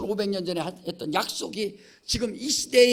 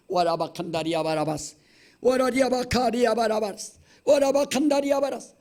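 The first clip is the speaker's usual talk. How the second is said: tongues demo; 5 semitones higher, 5 dB louder than usual. Shown as a demonstration. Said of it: "이 방언을 주신 거예요".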